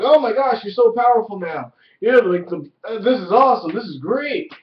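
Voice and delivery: mock gushing. Retelling Nero and the woman fawning over Zed, high loud mock gush. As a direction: gush over him like a starstruck fan.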